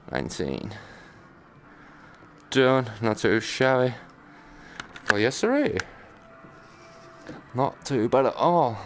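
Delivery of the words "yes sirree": ordinary talk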